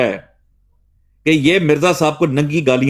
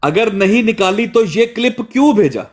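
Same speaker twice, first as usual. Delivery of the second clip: higher-pitched indignant challenge; indignant demand; 8 semitones higher than usual. Real, not acted. Host confronts his absent accuser over the clip, indignant demand. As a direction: demand an answer, pitch pushed up high.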